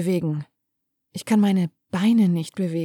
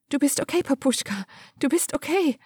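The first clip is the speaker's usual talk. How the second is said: higher, softer dazed repetition; as if in a trance; 7 semitones higher, 4 dB quieter than usual. A narrator's reading. Daughter in shock murmurs to her father, higher and trance-like. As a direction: chant it to herself, dazed. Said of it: "sage ich wie in Trance".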